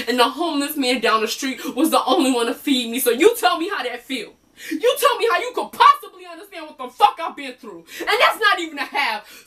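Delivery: high, loud, angry outburst; acted heated rant, building to a peak; [name] venting. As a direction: let it all burst out at the therapist. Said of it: "finally had a chance to do a little venting".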